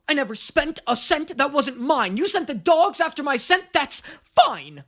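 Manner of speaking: high, loud impression of a funny-sounding guy. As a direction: push it up high and theatrical, then drop at the end. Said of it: "[name] is, like, my favorite voice to do".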